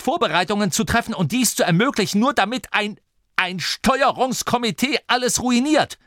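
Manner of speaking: angry tirade climbing high in pitch; trembling with anger. A narrator's reading. Fed-up leader scolds the steering committee, trembling with anger. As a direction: scold them, rising to a high, urgent pitch. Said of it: "Er bebte vor Zorn".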